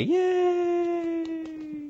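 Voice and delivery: squealed yay very high; high cheer. Real, not acted. Celebrating own announcement.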